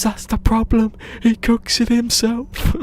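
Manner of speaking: very high, loud, slowed squeal; imitating the man. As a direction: shriek the punchline as the man. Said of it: "He goes".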